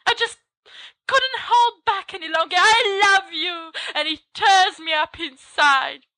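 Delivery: high, pleading confession voice; tearful confession. Acted. Student confessing love to the professor, high and pleading. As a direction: blurt it out high and trembling.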